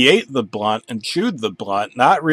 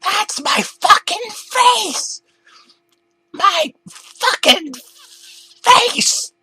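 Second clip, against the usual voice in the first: very high, slow, furious scream; furious shriek; 3 dB louder, much slower than usual. Acted. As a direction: rage at the mirror. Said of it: "Arthur got really angry".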